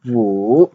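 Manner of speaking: Wu dipping low, then rising; dipping Mandarin tone on Wu; third tone. Shown as a demonstration. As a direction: draw Wu down and swing it back up. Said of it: "the third tone".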